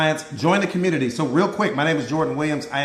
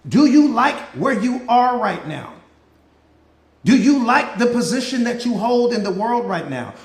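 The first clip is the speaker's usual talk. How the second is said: preaching; 3 semitones higher than usual. Real, not acted. Coach preaching rhetorical questions.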